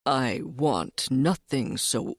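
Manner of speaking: slow, low, secretive cat; sly cat voice. Acted.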